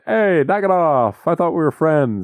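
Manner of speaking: high, drawn-out cartoon whine; put-on high voice, comically hurt; pecked scarecrow protesting. Scarecrow to pecking crows, high hammed-up protest.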